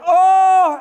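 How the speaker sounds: high, loud panicked shout. Retold or imitated. On the ledge over thin air, a high panicked shout. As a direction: cry out in alarm.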